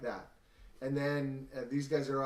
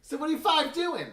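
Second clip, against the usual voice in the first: goblin voice; 8 dB louder than usual. Acted. GM voicing goblin NPC.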